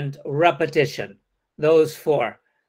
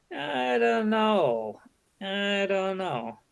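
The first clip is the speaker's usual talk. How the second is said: slow, soft, higher "I don't know", drawn out; slow hesitant "I don't know"; 3 semitones higher, 6 dB quieter, much slower than usual. Shown as a demonstration. Teacher showing the polite way, slow and soft. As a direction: trail off reluctantly. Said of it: "and not ... but".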